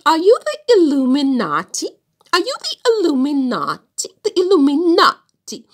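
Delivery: high, loud mock Italian exclamation; hammed-up Italian accent, punched loud and high. Vlogger mimicking her Italian student, heavy Italian accent. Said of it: "he has this heavy Italian accent".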